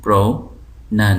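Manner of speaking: प्रोनाउंसिएशन chopped as pro - nan - siye - shan; four separate pieces. Shown as a demonstration. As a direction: say प्रोनाउंसिएशन piece by piece, pausing after each. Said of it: "चार टुकड़े में कर सकता हूँ".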